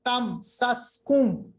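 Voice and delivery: تم سس کم very slow, syllable by syllable; clearly sounded mim. Shown as a demonstration. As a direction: close تم on a clear mim, then move on. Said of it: "دیکھیں میم کی آواز نکالی". (میم کی آواز صاف نکال کر)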